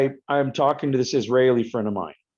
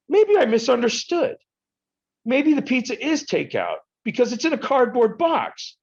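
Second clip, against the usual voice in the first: mock innocent reasoning; 12 semitones higher than usual. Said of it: "I'm like".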